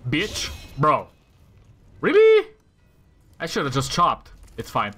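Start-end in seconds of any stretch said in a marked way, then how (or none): 2.0-2.5 high, loud squeak of disbelief; outraged at the miss; exasperated disbelief. Real, not acted. Game dodge frustration.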